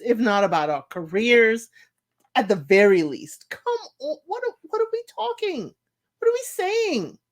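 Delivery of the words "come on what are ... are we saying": high-pitched exasperated pleading; exasperated whine. Real, not acted. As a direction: squeak out your disbelief. Ranting at cast about careers.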